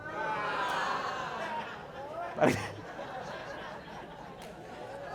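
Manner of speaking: high-pitched burst of laughter. Real, not acted. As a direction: laugh out at the memory. Laughing ending the sermon.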